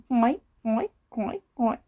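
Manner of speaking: lightly voiced pig grunting oi oi; a hint of a pig. A narrator's reading. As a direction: play the pig's grunt. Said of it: "passou um porco e a carrochinha disse".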